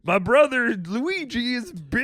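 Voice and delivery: high, slow cartoon plumber voice; Mario impression. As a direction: play Mario bragging about Luigi.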